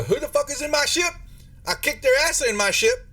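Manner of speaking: put-on high, brash young woman yelling; angry young crew member shouting about her ship; furious young woman. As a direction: voice a cocky young woman ranting.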